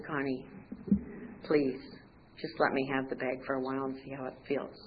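soft, low coaxing; gentle plea. Retold or imitated. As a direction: quietly coax with patient pauses. God's voice gently asks for bag.

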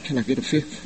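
hushed, meek "Can I get a fifth?". Retold or imitated. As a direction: whisper it meekly like a timid pop star. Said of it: "Sounded like [name] on a bad day".

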